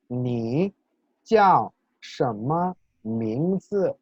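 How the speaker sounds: "Ni jiao shenme mingzi" very slowly, each word's tone drawn out; tone demonstration. Shown as a demonstration. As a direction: model "Ni jiao shenme mingzi" word by word, "jiao" falling sharply. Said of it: "interesante combinación de tonos que aparece". ("Ni jiao shenme mingzi" muy lento, marcando cada tono)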